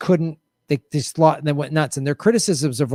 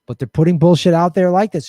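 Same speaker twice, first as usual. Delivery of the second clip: normal talking voice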